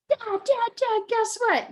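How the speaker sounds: put-on high, eager kid calling dad; excited kid. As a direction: call for dad like a bouncing child. Child running to dad.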